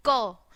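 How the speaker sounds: क as a short, hard k sound; letter sound k. Shown as a demonstration. Teacher showing C's hard k sound to the class. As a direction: snap out क firmly for the kids. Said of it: "सी की sound कैसे निकलती है".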